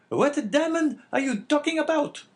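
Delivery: very high, loud woman's character voice; astonished wife. Acted. Wife demanding to know about the diamond, high-voiced.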